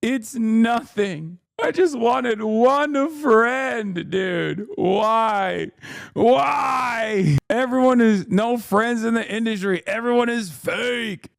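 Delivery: overplayed tearful whine, drawn out slow; hammed-up crying plea. Mocking a tearful aide to her podcast listeners, hammed-up sobbing whine. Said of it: "[name] added".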